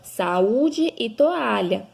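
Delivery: saúde and toalha drawn out syllable by syllable; slow syllable by syllable. Shown as a demonstration. Teacher to pupils, slow syllable-by-syllable example words. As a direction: sound out the example words slowly. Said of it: "Por exemplo".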